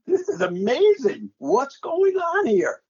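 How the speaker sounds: high, disbelieving outburst, slowing at the end; astonished. Retold or imitated. Announcer recalling his amazement at the score, high and incredulous. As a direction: blurt it out, then stretch the last questions in wonder. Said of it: "I'm saying, like".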